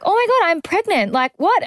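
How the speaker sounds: yelling to her partner at the car, thrilled and stunned; screamed out excitedly. Retold or imitated. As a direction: shout the news to her friend in disbelief. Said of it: "screamed out to Elodie at the car".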